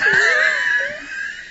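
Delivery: high wheezing prospector cackle; cackly wheezy old timer. Acted. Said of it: "turned into a grizzled old prospector".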